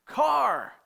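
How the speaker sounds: sudden sharp yell of alarm; warning shout. Retold or imitated. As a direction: shout it to stop a child. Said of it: "you shout the warning".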